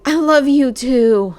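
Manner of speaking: slow, high, emotional confession; tearful confession. A narrator's reading. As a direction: let the truth burst out. Heroine finally confesses love.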